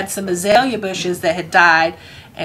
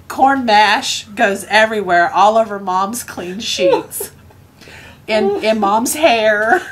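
laughing while telling; 3 semitones higher, slower than usual. Real, not acted. Storyteller reaching the exploded goat climax, laughing through it. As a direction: barely hold the laugh in.

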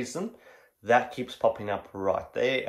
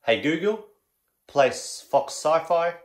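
repeating to voice assistant; 6 semitones higher, 4 dB louder, slower than usual. Real, not acted.

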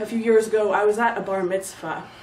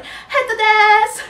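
put-on high, loud, drawn-out squeal like a little girl; five octaves up; 11 semitones higher, 7 dB louder, slower than usual. Shown as a demonstration. Comedian shows how high their voice gets in Japanese, squealed high. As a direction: squeal it far above your own voice, stretched out. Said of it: "my voice comes up five octaves".

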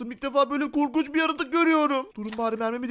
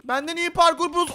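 ordinary talk, nothing marked

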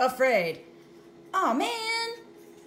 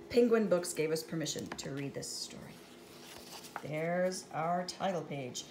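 ordinary delivery

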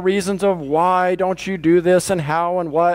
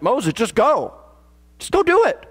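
exasperated command, jumping higher on the repeat; God getting angry; 6 semitones higher than usual. Retold or imitated. God to Moses after excuse upon excuse, mildly angry. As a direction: imitate a fed-up order, pitch climbing. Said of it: "God finally gets angry".

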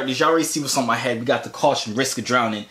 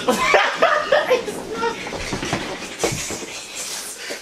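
high, drawn-out helpless laughter; real laugh. Real, not acted. Big brother laughing as his brother panics, helpless cackle. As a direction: laugh until the words are gone.

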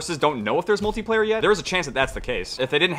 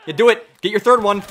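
louder daring taunt; 7 semitones higher, 7 dB louder than usual. Real, not acted. Daring teammate to team-kill.

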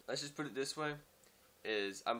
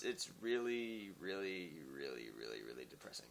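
fading to a murmur; 6 semitones lower, 8 dB quieter than usual. Real, not acted. Trails off about his sad song.

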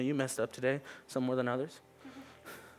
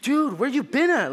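much higher and louder, slowed scolding of the dog; mock-angry owner yelling at his dog; exasperated scolding; 11 semitones higher, 8 dB louder than usual. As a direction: scold the runaway dog, half joking. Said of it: "I'm so pissed when I find him. I'm like".

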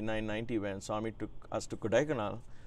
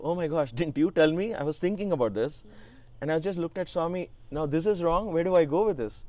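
louder, higher, rushed flustered panic; flustered shock; 6 semitones higher, 5 dB louder, faster than usual. Retold or imitated. Speaker recalls panicking when Swami teased him. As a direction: blurt it out, caught off guard.

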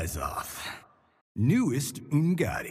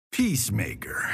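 whisper; 4 semitones lower, faster than usual. Acted. Naming the character in a whisper. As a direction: whisper Peacemaker.

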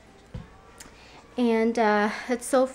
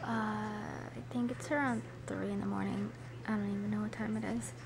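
hushed late-night whisper; 13 dB quieter, faster than usual. Real, not acted. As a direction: whisper so nobody wakes. Late night, child sleeping.

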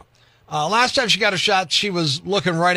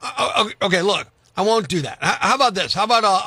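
normal talking voice

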